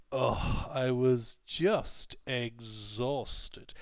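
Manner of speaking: JUST and EXHAUSTED drawn out slowly with heavy emphasis; intense emphatic intonation. Shown as a demonstration. Teacher acting out an excuse for missing the party, intense intonation. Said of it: "it'll be spoken with an intense intonation".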